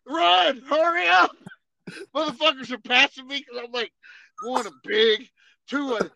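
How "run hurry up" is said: loud, high imitated command; mock drill instructor yell; instructor shouting orders. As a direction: yell at a straggler to hurry. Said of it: "Some guy on a megaphone".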